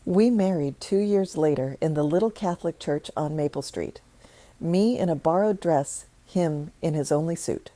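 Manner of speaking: plain reading voice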